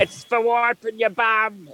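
put-on high, slow, broad Australian accent; put-on Aussie film-hero voice, drawn out; Crocodile Dundee. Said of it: "You do that one well".

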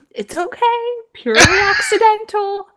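very high, hammed-up placating voice; squeaky pretend innocence, smoothing it over; nervous mock reassurance. Imitating friends breaking news of the shooting, nervous reassurance.

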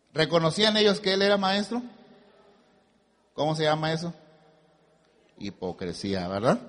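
usual speaking voice

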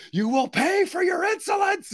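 high, shouted hammed-up villain line; mock villain threat. Quoting a movie villain to his wife, hammed-up shout. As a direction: boom it out like a cartoon villain. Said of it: "I'm like".

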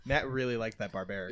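ordinary delivery

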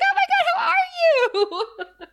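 very high, drawn-out, laughing squeal; giggly greeting squeal. Retold or imitated. Two old demon friends hug, giggly high-pitched squeal. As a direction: gush like old girlfriends reuniting. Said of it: "starts to giggle and they hug".

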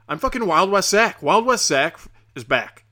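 loud, high mock-heroic boast; hyped-up announcement. Announces his cowboy persona.

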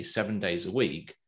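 ordinary delivery

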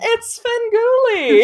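high, loud, slow dramatic announcer call. Pretend. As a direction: belt the name like a showman's intro. Echoing the name dramatically.

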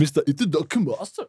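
hammed-up fake Japanese accent. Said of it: "auf Pseudo-Japanisch wiederholen".

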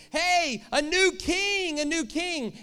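high, loud crowd cry of joy; loud, high excited cheering. Retold or imitated. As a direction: hail the new king like a thrilled crowd. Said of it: "people start getting excited".

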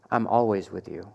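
tender father. Retold or imitated. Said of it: "And the father says".

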